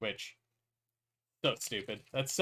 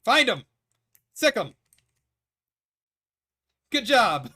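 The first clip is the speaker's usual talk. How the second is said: loud, high cheering at the game; excited shouting; 7 semitones higher, 8 dB louder than usual. Real, not acted. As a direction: yell encouragement at teammates.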